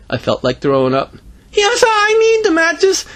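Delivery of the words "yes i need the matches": very high, loud, drawn-out cry; panicked kid. Acted. Boy gives in to the undertaker, high panicked squeal. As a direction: squeal it like a cornered child.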